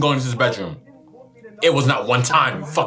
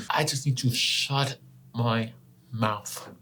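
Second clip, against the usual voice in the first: slow, much softer, trailing away; quiet self-scold; 8 dB quieter, slower than usual. Real, not acted. Regretting her words while watching, slow and much softer. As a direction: mutter it to yourself, deflated. Scolding herself after scene.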